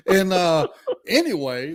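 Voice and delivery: can't stop laughing as you carry on; real laughter spilling into speech; laughing through words. Real, not acted. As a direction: still laughing, words squeezed out between laughs. Laughing after the punchline.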